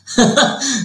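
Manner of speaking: short burst of high laughter; quick amused laugh. Real, not acted. Laughs at own childhood story.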